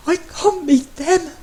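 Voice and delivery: high, slow, tearful plea; a high voice near breaking into a sob; sob in his voice. Acted. Matt pleading to his husband, close to tears. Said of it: "a sob in his voice".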